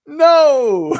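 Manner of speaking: high loud hammed-up no; patient refusing the diagnosis, high mock shout. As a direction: yell it in mock disbelief. Denial in retold scene.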